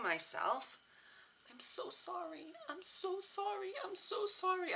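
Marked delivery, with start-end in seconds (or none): 1.5-4.7 high, slow, choked-up apology; tearful apology. Real, not acted. Woman apologizing to her younger self, genuinely tearful. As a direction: apologize slowly through rising tears, voice high. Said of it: "I'm, like, getting emotional even talking about it now".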